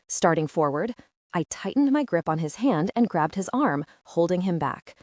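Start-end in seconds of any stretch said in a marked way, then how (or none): none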